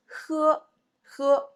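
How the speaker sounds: modelling the word. Shown as a demonstration. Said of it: "teacher models new word".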